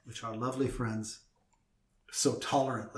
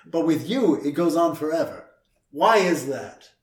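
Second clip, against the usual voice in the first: louder, playful indignation; mock accusation; 3 semitones higher, 10 dB louder than usual. Real, not acted. Host pretending to complain that cohost stalls, mock-indignant. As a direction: tease her as if scandalised.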